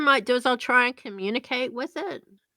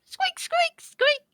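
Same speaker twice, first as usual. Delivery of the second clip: rubbery high squeaky toy; high squeaking; slower than usual. Acted. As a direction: squeak like a tiny rat. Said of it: "And you're squeaking again".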